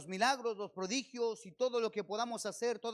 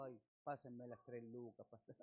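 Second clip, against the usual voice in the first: whiny beggar voice, much softer and slow; a lightly hammed-up whine; mock whining; 10 semitones lower, 14 dB quieter than usual. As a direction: mimic a whimpering plea for money. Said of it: "no ando lloriqueando".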